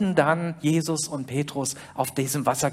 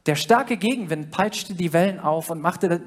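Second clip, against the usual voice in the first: ordinary delivery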